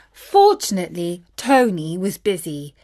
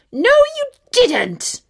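much higher, louder, slower snap back; sharp retort; 6 dB louder than usual. A narrator's reading. Wicked queen snaps at the king in a slow, shrill retort. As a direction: fire it straight back at him. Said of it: "fired back the queen".

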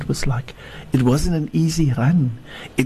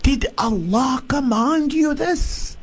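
desperate questioning shout; 14 semitones higher than usual. Acted. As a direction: shout the question after him. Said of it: "she shouted the next time".

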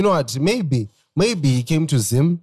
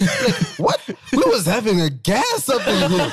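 laughing disbelief; 6 semitones higher than usual. Retold or imitated. Laughing over coworkers' question about boss.

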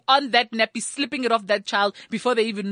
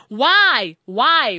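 loud why; 6 dB louder than usual. Real, not acted. Ranting at judging moms.

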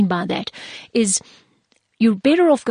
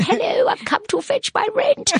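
put-on high, wavering old lady; 14 semitones higher than usual. To the tenant as an eighty-year-old landlady, high granny voice. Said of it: "an eighty-year-old lady eventually knocking".

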